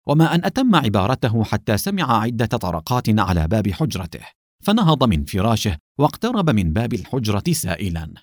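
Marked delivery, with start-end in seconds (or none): none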